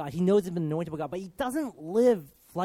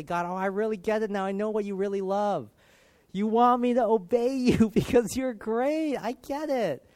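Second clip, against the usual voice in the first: high, rising fervour, building louder; excited realisation; 5 semitones higher, 4 dB louder than usual. Acted. Believer telling God they finally get it, excited. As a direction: act out a joyful breakthrough, pitch climbing.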